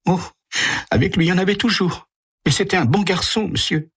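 high, fast pleading voice; a shaken mother begging for answers; distressed mother. Acted. Mrs Andrews asks the detective who killed her son, high and pleading.

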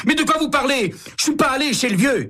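cornered man crying out in denial; mock yell, pitched high; suspect yelling. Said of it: "Fischer hurle".

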